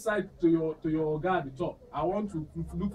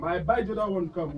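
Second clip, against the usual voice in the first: ordinary talk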